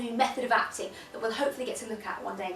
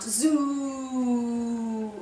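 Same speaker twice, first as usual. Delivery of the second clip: long, sustained zoo; deep voice. Shown as a demonstration. Teacher leads voice warm-up, deep held note. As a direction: hold the vowel in deep voice. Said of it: "in a really nice deep voice".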